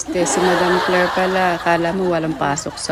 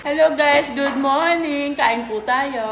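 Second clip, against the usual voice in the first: bright vlogger greeting; vlog opening; 6 semitones higher, slower than usual. Acted. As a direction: greet the viewers, upbeat. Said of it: "ano yung opening".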